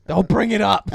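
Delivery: high, loud protest. Real, not acted. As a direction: shut it down loudly.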